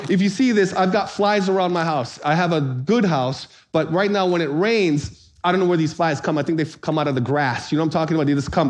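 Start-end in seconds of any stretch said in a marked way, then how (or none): none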